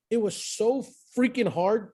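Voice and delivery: usual speaking voice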